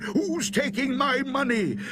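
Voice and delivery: high, indignant cry; alarmed shout. A narrator's reading. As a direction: challenge an unseen thief, pitched high. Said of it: "the gravedigger shouted".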